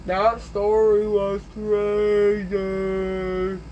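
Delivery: extremely slow, stretched vowels; exaggerated drawl. Storyteller repeating the verdict, hugely drawn-out delivery. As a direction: drag each word out as long as possible.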